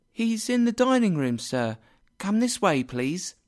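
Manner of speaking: high, polite servant's voice; high-voiced servant girl. A narrator's reading. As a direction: answer as an obliging maid, pitched well up. Servant girl answers Scrooge.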